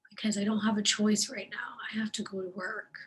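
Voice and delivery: normal talking voice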